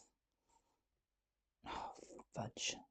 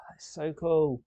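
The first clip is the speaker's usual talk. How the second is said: excited; 5 semitones higher, 7 dB louder, slower than usual. Real, not acted. Excited at finished shot.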